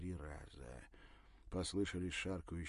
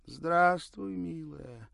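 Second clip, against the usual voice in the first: put-on much higher, cracked old woman's voice; old crone voice; 11 semitones higher, 13 dB louder, much slower than usual. A narrator's reading. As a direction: creak like a hunched old granny. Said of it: "надтреснутый старческий голос".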